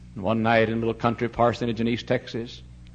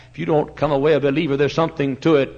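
ordinary delivery

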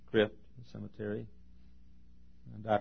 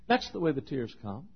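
ordinary talk, nothing marked

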